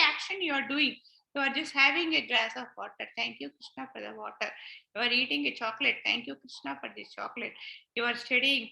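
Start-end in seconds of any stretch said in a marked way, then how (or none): 3.2-4.5 soft, low little prayer; quiet thanks. Acted. Devotee thanking Krishna for water, quiet prayer. 6.0-7.5 soft little prayer, dropping away; murmured thanks, kept small and private; quiet thanks. Acted. Devotee thanking Krishna for a chocolate, quiet prayer.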